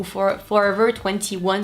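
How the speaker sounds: slow English accent, T sounded in "twenty". Shown as a demonstration. Vlogger showing viewers another variant, in a careful English accent. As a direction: say "Forever twenty-one" slowly in careful English. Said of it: "ça dépend comment on le dit".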